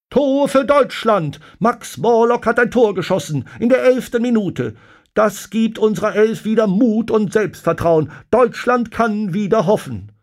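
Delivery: high, loud radio sports commentator; jubilant commentary. A narrator's reading. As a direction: shout the news to the whole nation. Radioreporter bejubelt das deutsche Tor.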